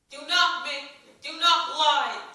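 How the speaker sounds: slow, very loud angry yell. A narrator's reading. Villager yells at Ellie not to lie, slow and loud. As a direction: drag out each word and roar it. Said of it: "yet another man yelled".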